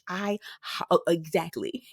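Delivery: usual speaking voice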